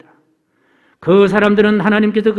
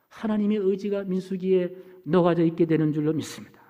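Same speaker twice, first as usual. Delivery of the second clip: usual speaking voice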